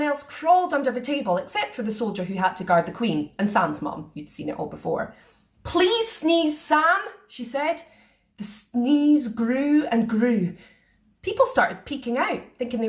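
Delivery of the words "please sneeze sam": urging. A narrator's reading. Mum urges.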